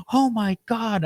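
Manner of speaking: wife catching her husband in bed; shocked wife. Retold or imitated. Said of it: "the wife's like".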